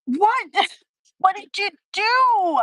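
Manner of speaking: imitating a stunned daughter; mock kid asking what mom did; shocked kid. Daughters find the piled-up room.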